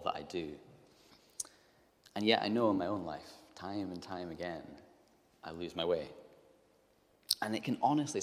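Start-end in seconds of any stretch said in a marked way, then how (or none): none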